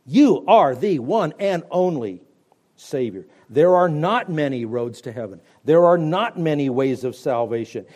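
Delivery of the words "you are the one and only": ordinary delivery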